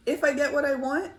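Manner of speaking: If I get what I want, asked high and fast; high anxious question. Shown as a demonstration. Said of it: "some reflections that would be useful".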